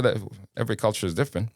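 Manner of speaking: ordinary talk, nothing marked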